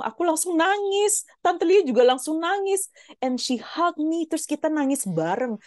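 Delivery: emotional, high-pitched telling; emotional high retelling. Real, not acted. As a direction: tell it high and swept up. Retelling crying with aunt.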